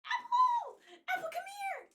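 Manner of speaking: very high, loud call for the dog. Real, not acted. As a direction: call her across the house. Calling the dog.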